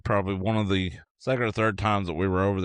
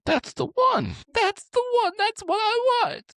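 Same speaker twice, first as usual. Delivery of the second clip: hammed-up tearful wail, very high; mock bawling; 3 dB louder than usual. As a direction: imitate someone bawling with joy over a find. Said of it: "she starts bawling, you know, nasty crying".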